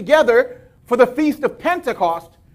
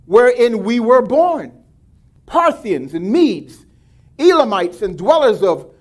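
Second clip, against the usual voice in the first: amazed crowd reading; 4 semitones higher than usual. A narrator's reading. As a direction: read the crowd's wonder, building. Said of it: "saying one to another".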